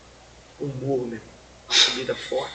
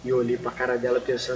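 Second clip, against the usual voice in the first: ordinary talk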